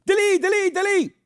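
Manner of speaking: high shrill mock screaming of delete; high-pitched mock yelling, repeated; screaming Egyptian guards. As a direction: shriek it over and over like angry guards. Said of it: "They're screaming at the top of their lungs".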